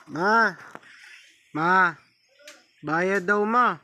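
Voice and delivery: loud call to the next room. Real, not acted. Gamer yelling to his mother about a payment, loud call. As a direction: shout for mom across the house.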